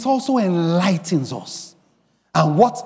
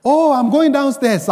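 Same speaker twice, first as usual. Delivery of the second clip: high, eager reply; excited; 7 semitones higher, 3 dB louder than usual. Retold or imitated. Brother replying to his pastor about going downstairs, excited. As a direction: answer brightly excited, pitched up. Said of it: "he repeated it excitedly".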